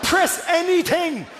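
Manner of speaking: high, slow, desperate begging; mock pleading soldier. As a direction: beg the player like a stranded soldier.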